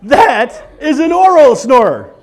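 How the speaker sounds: slow, loud snoring through the words; mimicked oral snore. Shown as a demonstration. Comic demonstrates oral snoring to audience, loud slow snore-talk. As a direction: snore the line through an open mouth. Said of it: "it kinda sounds like".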